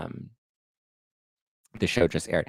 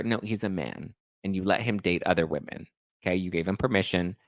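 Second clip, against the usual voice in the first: ordinary talk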